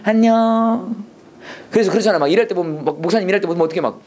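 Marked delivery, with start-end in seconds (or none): 0.0-1.1 long drawn-out, higher hello; gentle sing-song greeting, very slow; very soft and friendly. Shown as a demonstration. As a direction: stretch the greeting out sweetly. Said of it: "사람 만나면 아주 부드러워져야 돼".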